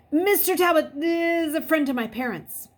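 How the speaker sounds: high, loud urgent plea; pleading. A narrator's reading. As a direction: push it, desperate. Pleading on phone.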